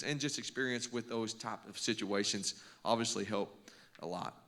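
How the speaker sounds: normal talking voice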